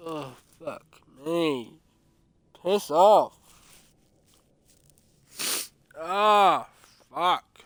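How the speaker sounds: loud, exasperated swearing; frustrated cursing. Real, not acted. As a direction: curse out loud at a sneeze. Cursing a sneeze fit.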